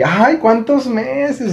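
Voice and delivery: imitación aguda, muy alargada, de enternecimiento; pregunta imprudente imitada. Retold or imitated. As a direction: pregunta con ternura fingida, arrastrando las palabras. Imita una pregunta imprudente a una chica. (ternura fingida, voz aguda y lenta)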